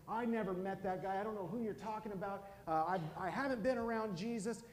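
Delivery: loud, high-pitched acted outburst; angry swearing denial. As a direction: deny it hotly, voice pushed up and loud. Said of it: "started cussing at this little girl".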